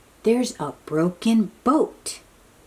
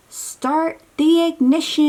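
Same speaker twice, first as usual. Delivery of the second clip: loudspeaker announcement; 6 semitones higher, 4 dB louder than usual. Retold or imitated. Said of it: "And someone says".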